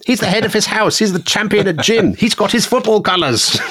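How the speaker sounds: high, breathless mock panic; hammed-up schoolboy horror at what's been done; panicked schoolboy.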